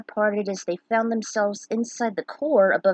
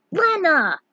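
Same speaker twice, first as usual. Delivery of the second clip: excited shout; 8 semitones higher, 7 dB louder than usual. Acted. Bart greets Lena, character voice.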